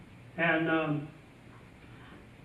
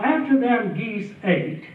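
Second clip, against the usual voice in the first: ordinary talk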